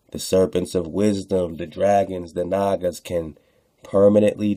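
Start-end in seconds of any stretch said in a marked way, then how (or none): none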